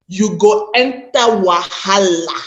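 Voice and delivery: slow Nigerian pidgin accent. Shown as a demonstration. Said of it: "let me use my Nigerian pidgin accent".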